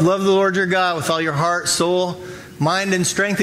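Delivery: usual speaking voice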